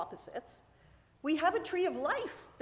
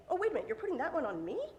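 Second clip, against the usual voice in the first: quick, higher disbelieving question; incredulous; 4 semitones higher, much faster than usual. Acted. Cain objects to God's blame, incredulous. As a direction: rattle it off as a baffled protest. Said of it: "Cain is saying".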